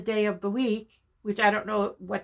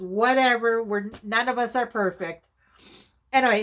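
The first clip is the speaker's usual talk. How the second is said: ordinary talk, nothing marked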